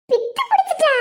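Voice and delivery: high, incredulous echo; startled high-pitched query; shocked question. Acted. Bystander shocked by the news.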